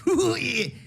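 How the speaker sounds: real high burst of laughter. Real, not acted. Laughing at the violence.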